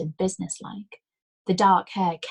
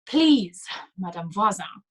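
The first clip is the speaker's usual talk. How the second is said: pained plea; 5 dB louder than usual. A narrator's reading. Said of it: "a trace of expression".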